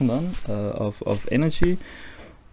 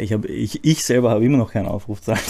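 normal talking voice

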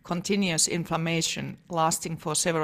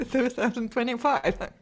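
ordinary delivery

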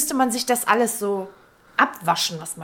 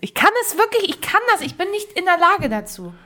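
whiny protest; 7 semitones higher than usual. Real, not acted. Protesting the accent task.

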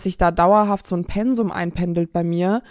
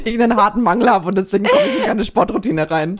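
louder, laughing through the words; 3 semitones higher, 5 dB louder than usual. Real, not acted. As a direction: laugh as you joke about it. Jokes about protein deficiency.

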